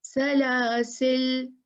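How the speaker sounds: सलासिल recited very slowly, ending on the ल with no final alif; the stopping choice, recited; stopped without alif. Shown as a demonstration. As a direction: stop on सलासिल and drop the alif. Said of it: "अलिफ उड़ गया".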